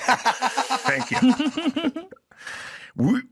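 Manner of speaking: high giggle through the words; laughing. Real, not acted. Comic cracking up at his own fried potato line, laughing. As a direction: break up laughing mid-sentence.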